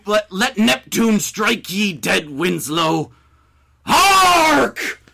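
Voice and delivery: loud, high theatrical curse in a put-on accent; heartbroken fury. Heartbroken cook cursing the man who insulted his lobster, furious. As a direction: bellow it like an old sailor damning a man. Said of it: "Heartbroken and furious".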